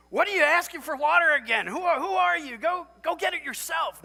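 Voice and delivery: high, loud, exasperated shout; mock angry dad. Tired father yelling at his sick son, mock exasperated shout. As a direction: yell like a fed-up father at midnight. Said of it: "do I say".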